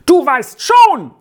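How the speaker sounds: loud, high, drawn-out shriek; screeching boy. A narrator's reading. Boy quarrelling with Peppino, loud high screech. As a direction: screech it slowly at the other boy. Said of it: "kreischte der eine".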